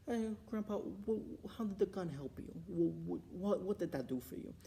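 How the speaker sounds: puzzled, stumbling acted question; incredulous questioning. Grandchild questioning Grandpa about his gun, incredulous.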